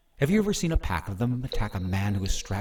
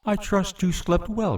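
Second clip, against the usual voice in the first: mocking; 10 semitones higher than usual. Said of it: "Kreia made a mocking bow".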